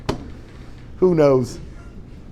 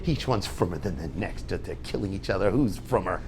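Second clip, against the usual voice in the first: much lower, much softer dry mock praise; sarcastic parent; 11 semitones lower, 14 dB quieter than usual. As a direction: mutter a sarcastic "gavaldik" like a tired parent. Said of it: "and the parents go".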